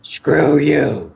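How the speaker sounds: harsh devil-like yell from behind a door; menacing, demonic mock scream; imitated angry scream. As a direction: scream it back like the devil himself. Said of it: "screams back at us in a voice that sounded just like this".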